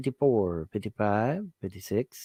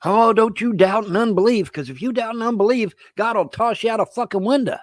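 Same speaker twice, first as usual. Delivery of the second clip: higher, louder sanctimonious warning; 9 semitones higher, 5 dB louder than usual. Retold or imitated. Man mocking Christians who threaten doubters, higher and louder. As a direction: lampoon a scolding believer.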